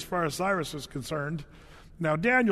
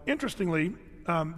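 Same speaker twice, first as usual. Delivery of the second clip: ordinary delivery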